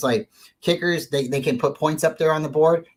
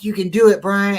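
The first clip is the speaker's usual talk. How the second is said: higher, louder hyped cheer; pump-up cheer; 7 semitones higher, 7 dB louder than usual. Retold or imitated. Teammate cheering on the kicker, excited and loud. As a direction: fire him up like a teammate. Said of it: "pump me up and you're like".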